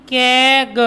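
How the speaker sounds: केग stretched in two parts; sounded out. Shown as a demonstration. Teacher moving to the next word, केग slowly segmented. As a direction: hold "keh" in केग, then a separate "guh". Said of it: "केईजी".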